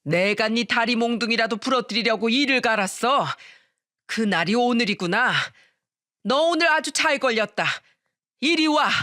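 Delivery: angry mother-in-law shouting. A narrator's reading. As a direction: build to a high, commanding cry. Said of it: "김 팀장 뺨을 치면서 소리쳤어요". (점점 높아지는 시어머니의 성난 외침)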